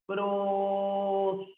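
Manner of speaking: "prosódico" drawn out very slowly, syllable by syllable; slow syllable-splitting demo of "prosódico". Shown as a demonstration. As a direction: stretch each syllable of "prosódico", almost sung. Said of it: "esta palabra tiene cuatro sílabas".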